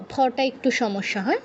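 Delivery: ordinary delivery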